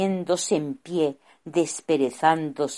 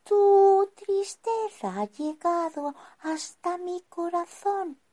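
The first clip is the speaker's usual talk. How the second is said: put-on young wizard voice. Wizard speaks kindly.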